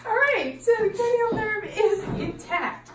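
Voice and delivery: talking through real laughter; words shaking with a laugh. Real, not acted. Exam dissolves into laughter.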